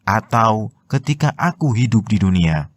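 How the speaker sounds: normal talking voice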